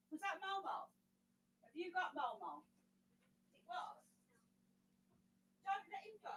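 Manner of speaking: calling from another room; distant, away from the microphone; far off mic. Real, not acted. Walked away, calling to Jack.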